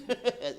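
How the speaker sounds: bursting laugh; a big burst of laughter; hearty laugh; loud laugh. Real, not acted. Laughs at own story.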